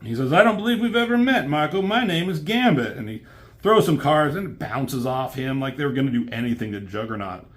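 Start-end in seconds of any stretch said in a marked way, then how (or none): 0.3-3.0 much higher, fast, hammed-up Southern-tinged accent; Gambit's put-on accent. Gambit introducing himself to Juggernaut, mock-suave put-on accent. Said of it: "as dumb as your accent".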